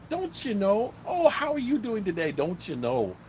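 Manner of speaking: high-pitched mock Minnesotan, falling at the end; Minnesota accent. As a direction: imitate an over-friendly Minnesota local. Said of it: "everywhere you went".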